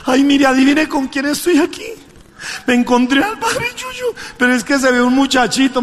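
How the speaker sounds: mock thrilled woman on the phone; thrilled gossip. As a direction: tell the news breathlessly thrilled.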